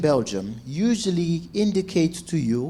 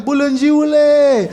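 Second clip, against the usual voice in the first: loud, high, drawn-out holler; acted-out delighted yell to a friend; gleeful greeting; 9 semitones higher, 9 dB louder, slower than usual. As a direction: shout an insult as a joyful hello. Insult as greeting to best friend.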